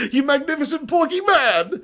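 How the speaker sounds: gleeful echo of a fan's compliment; delighted laughing repeat. Real, not acted.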